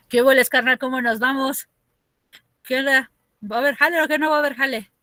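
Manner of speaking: put-on loud, fast Mexico City slang accent; chilango street accent. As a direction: play a streetwise chilango asking for work. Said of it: "la gente con este acento". (acento chilango de barrio, rápido)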